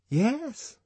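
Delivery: high eager yes; Fanny Crown agreeing. A narrator's reading. Fanny chiming in at the party, high eager voice. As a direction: chime in high and keen. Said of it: "came the voice of Fanny Crown".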